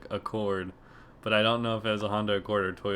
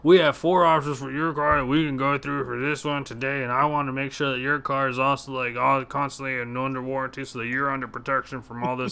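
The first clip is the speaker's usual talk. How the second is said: robot salesman; 4 semitones higher than usual. Retold or imitated. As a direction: rattle off the script like a machine. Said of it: "this guy sounds like a fucking robot".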